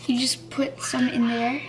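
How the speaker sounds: hushed whisper so the sister won't hear; secret whisper. Real, not acted. Whispering to viewers while setting up the prank. Sneaking ketchup bomb into place.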